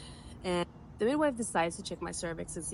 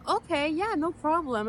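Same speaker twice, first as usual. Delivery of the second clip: high, lifted, easygoing yes; retold breezy okay, pitched well up; breezy agreement; 9 semitones higher, 4 dB louder than usual. Woman telling the midwife it's fine, breezy and high.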